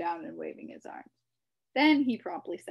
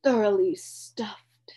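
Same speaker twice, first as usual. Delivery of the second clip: breathy, slow, sated sigh; 2 semitones higher, 11 dB louder, slower than usual. A narrator's reading. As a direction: sigh it out, stuffed full. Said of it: "sighed the Count".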